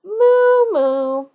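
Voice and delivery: drawn-out cow moo; playful cow moo. Acted. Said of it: "The cow lowered".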